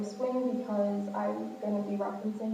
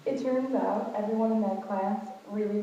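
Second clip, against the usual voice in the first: ordinary delivery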